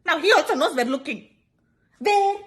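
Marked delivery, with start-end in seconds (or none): 2.0-2.4 high startled where; mock the friend's loud glance-around; exaggerated where. Friend mocking another who stared openly, high.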